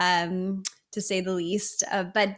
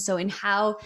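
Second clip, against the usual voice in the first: ordinary delivery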